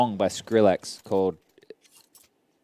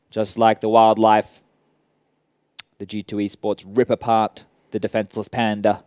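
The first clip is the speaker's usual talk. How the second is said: normal talking voice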